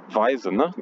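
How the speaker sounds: ordinary talk, nothing marked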